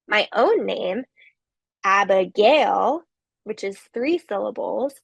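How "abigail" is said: "Abigail" drawn out slowly, stressed on GAIL; final-syllable stress. Shown as a demonstration. Lecturer demonstrating with own name, final syllable of "Abigail" stressed. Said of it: "has a stress on the final syllable".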